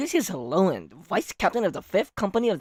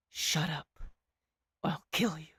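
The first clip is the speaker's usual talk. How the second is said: menacing whisper; 3 semitones lower, 9 dB quieter than usual. Pretend. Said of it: "And Shao just like".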